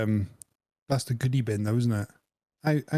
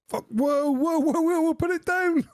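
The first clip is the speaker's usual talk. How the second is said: panicked shout. Pretend. Mock-panicked friend shouting at the airport guard. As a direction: yell at him to drop it like it's a bomb. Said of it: "You should have said".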